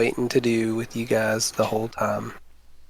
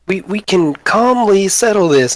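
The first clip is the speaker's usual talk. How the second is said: higher soothing goblin; calm them down, pitched up; placating goblin; 7 semitones higher than usual. Acted. Boblin calms the big ones, pitched up. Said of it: "he's like".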